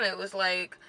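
ordinary delivery

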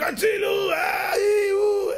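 very high, loud cry for help, the last word stretched; boy trapped in the potato grinder yells for help; screaming for help. Retold or imitated. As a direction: scream it as if the machine is pulling you in. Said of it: "צועק".

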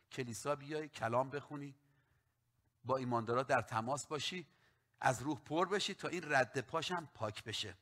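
ordinary delivery

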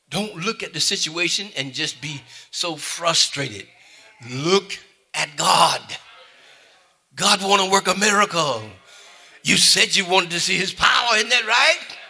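loud, high, rising preacher's call; preaching at full force. Acted. Preacher urging the church to trust God, loud and rising. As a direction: whoop it up to the congregation.

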